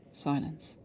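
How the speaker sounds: plain reading voice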